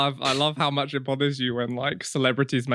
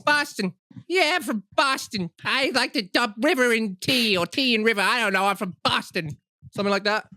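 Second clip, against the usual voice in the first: a hint of Boston accent, pitched up; goofy Boston guy voice; mock Boston accent; 5 semitones higher than usual. Asked how Boston people sound, a light mock Boston voice. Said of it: "What do Boston people sound like?".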